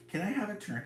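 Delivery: high little voice asking; a tiny creature pleading for a turn; small unicorn voice. Acted. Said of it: "he asked".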